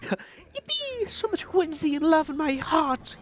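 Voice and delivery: high, gushing delight; bubbling over with joy. Real, not acted. Joyful gushing about the game.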